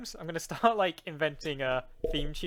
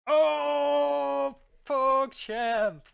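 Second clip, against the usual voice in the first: loud, high, sustained cry of delight; thrilled outburst; 12 semitones higher, 11 dB louder, much slower than usual. Real, not acted. Gamer opens a pack and gets a legendary, thrilled shout. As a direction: blurt it out loud and high, stretching the Oh.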